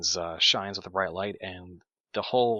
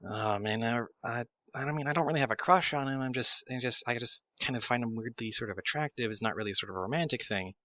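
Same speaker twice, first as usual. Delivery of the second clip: Aurelia; 3 semitones higher, faster than usual. Acted. Aurelia's thoughts overheard by the party, higher hurried denial. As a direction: fumble through a quick, defensive denial in a lighter voice. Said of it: "Aurelia's voice saying".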